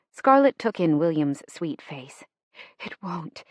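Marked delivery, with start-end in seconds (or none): 2.8-3.4 hushed, much softer reply; whispered. A narrator's reading. Sister answering a hope about lost love, whispered. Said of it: "she whispered".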